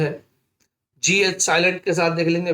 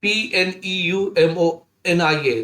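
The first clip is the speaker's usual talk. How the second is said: ordinary talk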